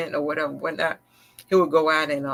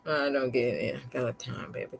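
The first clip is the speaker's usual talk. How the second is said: normal talking voice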